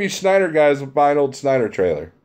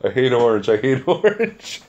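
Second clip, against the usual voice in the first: mock grumbling into laughter. Acting out a mental image of himself, laughing. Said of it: "mental image of me going".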